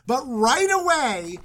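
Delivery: ordinary delivery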